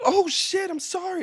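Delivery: high-pitched, hammed-up apology; mock remorseful dog. Speaking for the dog that just bit, high mock apology. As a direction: blurt it high as a guilty dog, then soften. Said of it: "an animal have that, like".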